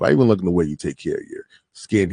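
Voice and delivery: ordinary talk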